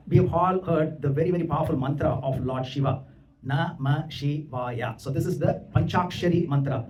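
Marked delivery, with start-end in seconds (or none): 3.5-5.0 very slow, each syllable separated. Shown as a demonstration. Teacher giving the Panchakshari mantra, syllable by syllable. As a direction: draw out every syllable of the mantra.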